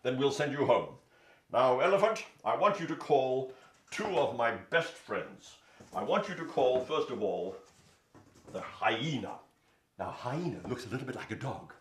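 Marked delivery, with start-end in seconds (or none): 0.0-9.4 loud regal lion. Acted. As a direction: declare it like a king. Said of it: "said Lion".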